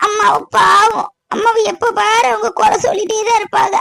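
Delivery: high-pitched tearful complaint; crying. Acted. Yazhini pours out her hurt to her aunt, crying. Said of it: "அழக்கூடாது, என்ன விசேஷம்லாம்".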